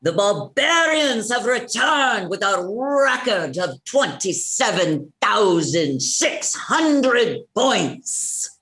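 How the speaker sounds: slow, stretched-out arena call; drawn-out triumphant announcement; announcer yell. Acted. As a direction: proclaim it to the crowd at arm's length from the mic. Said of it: "and now you can yell".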